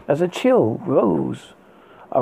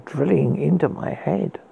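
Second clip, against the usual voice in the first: plain reading voice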